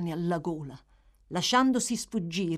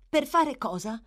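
higher, louder echo of the question; Nancy echoing; 7 semitones higher, 5 dB louder than usual. A narrator's reading. Girl echoes the boy's question back, evasive echo. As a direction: throw his words back at him. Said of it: "gli fece eco Nancy".

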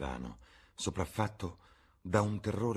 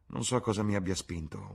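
plain reading voice

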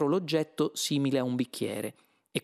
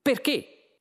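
high, loud, curt question; brusque; 12 semitones higher, 5 dB louder than usual. A narrator's reading. Arthur challenges the mouse's explanation, brusque. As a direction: ask it abruptly. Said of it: "chiese Arthur brusco".

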